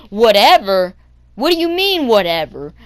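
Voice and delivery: loud mock-indignant echo, pitch leaping up; imitated outrage. Bus driver challenging kid's reply, loud imitated outrage. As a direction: throw it back loudly, imitating an offended driver.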